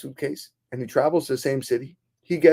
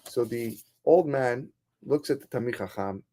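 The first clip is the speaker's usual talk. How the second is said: plain reading voice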